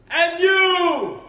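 slow, huge roaring giant voice at the top of the lungs; booming Goliath. Acted. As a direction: bellow the challenge like a giant warrior. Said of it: "it's better if I do it this way".

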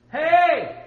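high, loud taunting hey; mocking call. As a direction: jeer at them across the altar. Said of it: "Elijah mocked them and said".

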